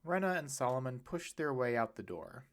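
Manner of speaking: ordinary delivery, nothing marked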